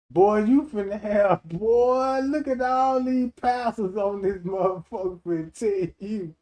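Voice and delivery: ordinary talk, nothing marked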